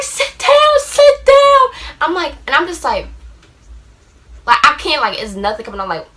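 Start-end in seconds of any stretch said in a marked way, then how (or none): 0.0-1.9 high-pitched urgent command, imitating mom; mom's urgent voice. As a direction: order her down like a scared mom. Mom ordering her to sit.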